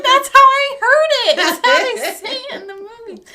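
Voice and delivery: high, loud laughing protest; defensive protest. Retold or imitated. Defending her pronunciation to James, loud and high laughing protest. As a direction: insist loudly, half laughing. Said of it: "I'm like".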